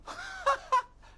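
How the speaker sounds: high loud scornful laugh; girl's mocking laugh. Lizzie laughs at Tom's dragon plan, high mocking laugh.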